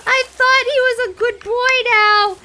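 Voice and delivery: high, loud mock-distraught wail; freaking out. Retelling running from Loki through the woods, freaking out. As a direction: wail it out in hammed-up panic. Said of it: "I'm, like, freaking out".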